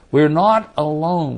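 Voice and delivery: normal talking voice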